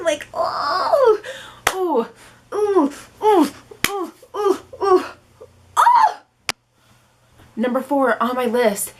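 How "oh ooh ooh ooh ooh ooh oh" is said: rising series of high shivery ooh cries; spine-chill yelps. Retold or imitated. Imitating a spine-chilling reaction to the creepy villain voice. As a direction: yelp like a shiver up your spine. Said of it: "like, really creepy voice that just kinda makes your spine".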